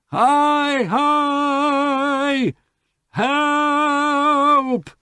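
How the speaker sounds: very high, loud cry for help; calling for help. A narrator's reading. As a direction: shout into the fog for rescue.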